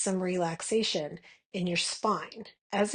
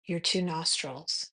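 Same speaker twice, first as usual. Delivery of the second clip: guided meditation. Acted. Meditation guide leading listeners to notice their breath, calm guided meditation. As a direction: guide them gently into the breath, unhurried.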